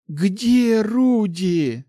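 high, loud, very drawn-out cry; disbelieving dread. A narrator's reading. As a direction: wail the question, refusing to believe it. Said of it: "Замирающим сердцем и не веря своим глазам".

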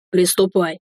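understated order; command. A narrator's reading. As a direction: give it as a short, restrained command. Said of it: "и скомандовал". (сдержанная команда)

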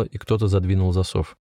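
plain reading voice

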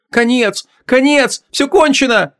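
high, frantic cry of panic; terrified soldier wailing; panicked scream. A narrator's reading. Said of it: "раздался чей-то истошный вопль".

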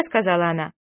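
plain reading voice

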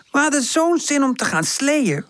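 sighing disappointment. A narrator's reading. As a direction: complain in a high, let-down voice. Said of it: "zucht Woezel". (hoog, teleurgesteld zuchten)

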